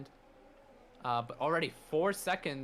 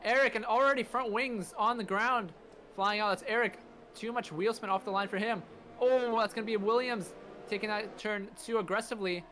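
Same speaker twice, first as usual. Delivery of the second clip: excited; 6 semitones higher than usual. Real, not acted. Race start, caller reacts to chaos.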